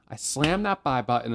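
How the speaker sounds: ordinary talk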